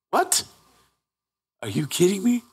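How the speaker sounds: high, quieted squeak of disbelief; retold "you can't be serious" reaction; incredulous. Acted disbelief at friend's demand.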